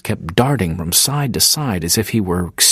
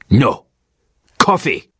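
stern insistence; 3 semitones higher, 4 dB louder than usual. Acted. Bedouin insists, spear pointed.

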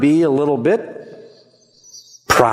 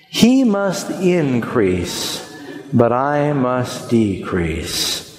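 very slow, drawn-out unison recital of "He must increase, but I must decrease"; slower group recital; 4 semitones lower, much slower than usual. Shown as a demonstration. Pastor saying the verse together with the congregation, very slowly. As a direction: stretch every word so the room can follow. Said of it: "Would you say that with me".

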